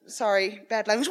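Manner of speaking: usual speaking voice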